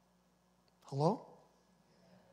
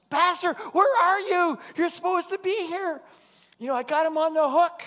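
impatient shout; 13 semitones higher, 14 dB louder than usual. Retold or imitated. Caller shouting at the late pastor, high and loud. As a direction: yell for the missing pastor. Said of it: "he says".

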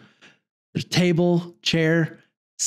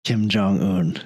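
deep official voice; 9 semitones lower than usual. Retold or imitated. Officials asking Dennis a favor.

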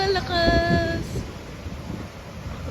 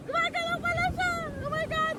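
very high, softer panicked squeal; real panic; 11 semitones higher, 7 dB quieter, much faster than usual. Real, not acted. Rider feels the ride moving, panicking. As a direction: squeal in alarm between screams.

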